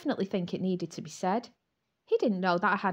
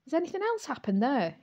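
smirking; 6 semitones higher than usual. A narrator's reading. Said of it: "he smirked".

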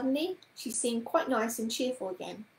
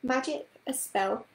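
plain reading voice

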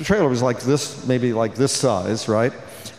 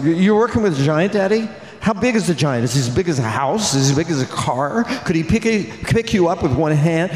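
put-on higher, eager child's voice asking questions; excited little daughter; 4 semitones higher than usual. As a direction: imitate a little girl pestering her dad. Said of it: "my daughter's going".

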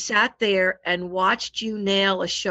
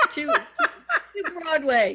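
laughing; 6 semitones higher, much slower than usual. Real, not acted. Mother laughing, repeating the word.

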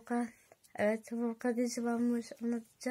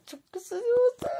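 delighted squeal; 11 semitones higher, 8 dB louder than usual. Acted.

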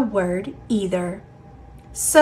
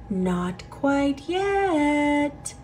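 slow, hushed and airy, softer; slow soft wind voice; 5 semitones higher, 6 dB quieter, much slower than usual. Acted. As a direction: breathe it out like a breeze. Wind gently refusing.

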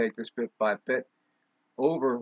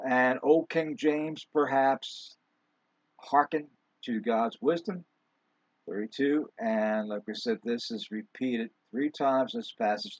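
normal talking voice

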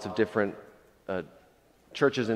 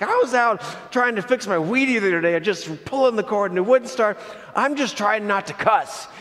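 high-pitched, louder comic griping; mock exasperated rant; 9 semitones higher, 4 dB louder than usual. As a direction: act out someone ranting in frustration. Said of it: "he keeps ranting".

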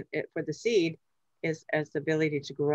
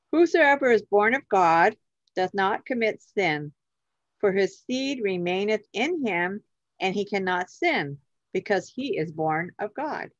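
measured, emphatic Bible reading; declaimed scripture, opening strong; 3 semitones higher than usual. Acted. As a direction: read the verse out clearly, phrase by phrase. Reads scripture aloud on request.

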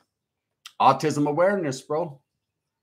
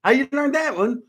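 mocking; 10 semitones higher, 4 dB louder than usual. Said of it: "I said".

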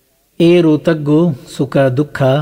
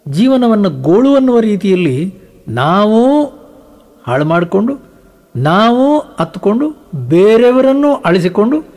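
rising rebuke; 6 semitones higher than usual. Real, not acted. Preacher rebuking listeners who wail about life, indignant and rising. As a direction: scold the crowd with mounting heat.

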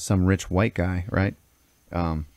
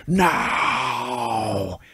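drawn-out roared cry, held back in volume; higher, long mock monster roar; monster scream; 4 semitones higher than usual. The fanged boy screams at Mouth, roaring. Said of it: "roars at him ... fangs and screams".

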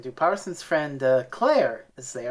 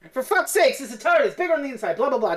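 high exasperated rant; exasperated Romana; 7 semitones higher, 5 dB louder, faster than usual. Retold or imitated. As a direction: brush it off, fed up. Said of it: "Romana's just like".

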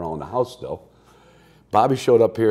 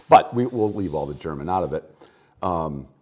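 normal talking voice

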